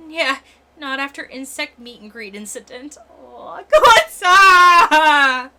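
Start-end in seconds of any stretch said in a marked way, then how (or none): 3.6-5.5 loud anguished cry; shout. Acted. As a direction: cry the name out in dismay. Crying out a character's name.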